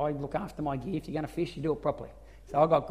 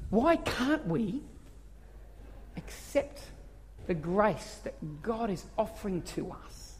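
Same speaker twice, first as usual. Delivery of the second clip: soft slow preaching; 2 semitones higher, 7 dB quieter, slower than usual. Real, not acted. Preacher slows, softens.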